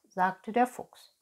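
plain reading voice

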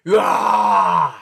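mock bear growl, rising. Reader imitating the angry bear, mock growl. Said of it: "bear's gonna growl and like".

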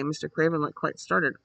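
usual speaking voice

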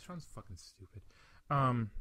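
usual speaking voice

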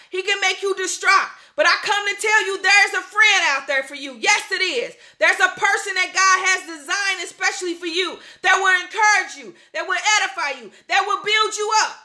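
ordinary talk, nothing marked

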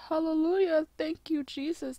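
high-pitched hallelujah of relief; acted-out joyful relief. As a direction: exclaim thanks as if finally vindicated. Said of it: "I just looked at it like".

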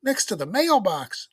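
put-on high, loud kid's squeak; excited squeak. A narrator's reading. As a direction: squeak the news out like a little boy. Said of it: "squeaked Robbie".

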